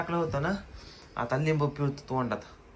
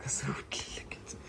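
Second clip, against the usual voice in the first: whispered aside, slow; 13 dB quieter, slower than usual. Real, not acted. Mother whispering to viewers about the sleeping kids. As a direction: hush it to the camera. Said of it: "whisper heard".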